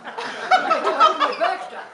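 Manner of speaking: slow, laughing and loud; laughing disbelief. Real, not acted. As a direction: laugh out the question.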